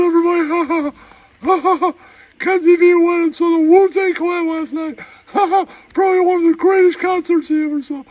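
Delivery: high, squeaky put-on character voice, with giggles; squeaky comic voice. Comic sidekick telling a bar story in a squeaky giggling voice. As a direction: play a giggling oddball sidekick.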